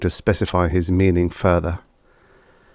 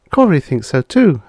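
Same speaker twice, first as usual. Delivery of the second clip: higher, brisk neighbourly woman; lightly voiced friend Lucille; 8 semitones higher, 4 dB louder than usual. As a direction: play the friend a bit higher.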